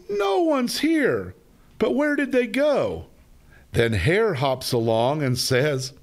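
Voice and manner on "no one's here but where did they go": high excited cry. Acted. Bear cries out high.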